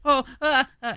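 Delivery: high panicked little cries; scared kid. Retold or imitated. Son panicking at buzzing bees, high yelps. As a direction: yelp like a small boy frightened of bees. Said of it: "And Graham's like".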